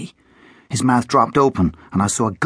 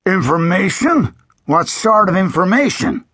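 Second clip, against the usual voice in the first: suspicious sheriff; 9 semitones higher, 3 dB louder than usual. A narrator's reading. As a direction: draw it out, wary and sly. Sheriff questions boy.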